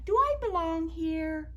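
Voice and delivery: high little voice asking courteously; polite small storybook character. Acted. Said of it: "said very politely".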